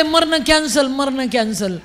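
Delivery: high, loud cry easing on the repeat; re-enacted defiant shout; retold yell. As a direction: shout it out as a sudden resolve. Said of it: "मी जोरात ओरडले".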